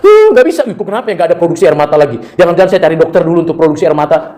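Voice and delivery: ordinary talk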